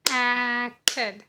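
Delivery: "Acted" drawn out in two separated parts; syllable by syllable. Shown as a demonstration. Teacher showing the second syllable, "Acted" slowly split. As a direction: stretch the start of "Acted", detach the ending. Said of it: "forms a second syllable".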